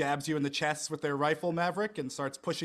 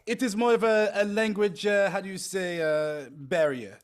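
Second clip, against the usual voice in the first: put-on French accent; 5 semitones higher, 5 dB louder than usual. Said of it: "that's how French works".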